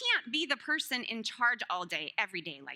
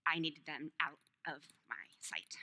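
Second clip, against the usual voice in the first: hushed confession, half joking; mock-guilty whisper; whispered aside; 7 semitones lower, 7 dB quieter than usual. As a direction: drop to a whisper for the punchline.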